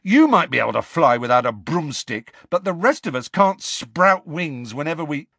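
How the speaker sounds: mild mock exasperation, rising; a touch of held-back temper; contained anger. A narrator's reading. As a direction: Ron snapping at Luna. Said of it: "barely containing his anger".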